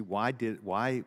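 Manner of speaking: ordinary talk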